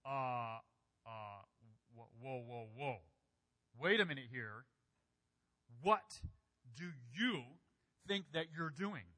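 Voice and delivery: halting disbelief building to a punched WHAT; incredulous. Shown as a demonstration. Preacher voices Peter stopping Jesus washing his feet, incredulous. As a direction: stammer to a stop, then challenge them in disbelief. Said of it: "conveys incredulity".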